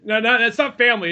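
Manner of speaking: usual speaking voice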